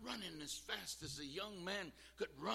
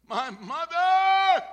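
high, very loud, drawn-out scream; retold scream; 20 dB louder, much slower than usual. Lady running toward the fire for her mother, screaming. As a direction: let the last cry tear out long and loud. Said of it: "another lady come running, screaming".

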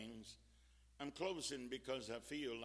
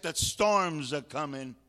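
loud warning repeat; 13 dB louder than usual. Real, not acted. Warning repeated after the shout.

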